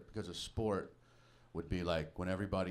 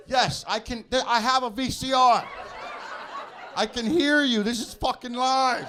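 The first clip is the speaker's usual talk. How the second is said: high, loud indignant shouting; mock outraged yell; 12 dB louder than usual. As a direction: blast it like he's insulted. Yelling at mailman's doubt.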